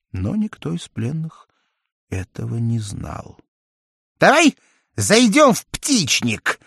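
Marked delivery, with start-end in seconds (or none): 4.2-6.6 high, slow playful jester. A narrator's reading. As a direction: coax him along like a jester. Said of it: "предложил Шут". (высокий голос шута)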